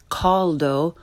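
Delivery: "caldo" slowly, with English aw; learner's mispronunciation. Shown as a demonstration. Teacher shows beginners' mistake, "caldo" with English aw. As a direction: say "caldo" as CAWL-doh. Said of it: "first the way most people".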